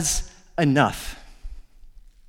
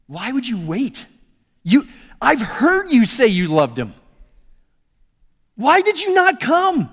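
building to much higher and louder accusation; angry grief; 8 semitones higher, 4 dB louder than usual. Acted. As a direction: jab a finger and demand where he was. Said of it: "I think she was probably pretty angry".

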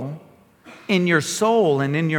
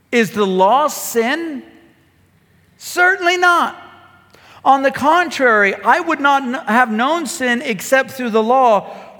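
preacher reading Scripture to the church, higher and emphatic; 5 semitones higher than usual. Acted. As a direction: proclaim the verse to the congregation.

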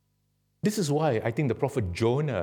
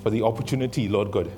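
earnest prayer, settling lower; closing prayer; 3 semitones lower than usual. Real, not acted. Preacher praying for the congregation, earnest prayer. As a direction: pray aloud in steady short phrases.

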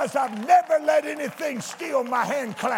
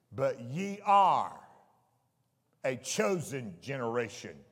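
slow reading; 10 semitones lower, 5 dB quieter than usual. Shown as a demonstration. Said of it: "I want to read it slowly".

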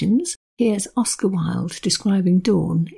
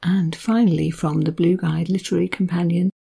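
ordinary talk

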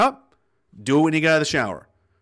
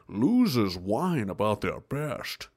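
slow, low, softer mock tough-guy quote; hint of a gruff action hero, slow and low; Sean Connery line; 6 semitones lower, 7 dB quieter, much slower than usual. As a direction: drawl it out like a hardened ex-con hero. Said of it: "he turns around and goes".

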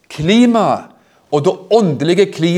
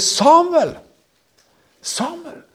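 God calling; 12 semitones higher, much slower than usual. Acted. Preacher calls the sleeping boy's name as God. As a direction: call out like a distant voice of God.

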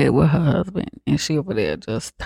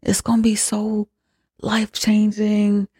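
higher, coaxing voice of an eager convert; a higher, wheedling mock sales pitch; pushy spiritual lady; 6 semitones higher than usual. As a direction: sell it like a pushy believer. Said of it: "So then she was like".

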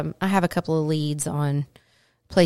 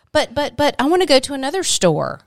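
put-on high, louder kid protest; whiny child; 8 semitones higher, 4 dB louder than usual. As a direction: beg to keep shopping. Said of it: "She was channeling my mother".

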